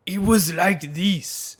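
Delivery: slow, loud, hammed-up old man voice; fake old man voice.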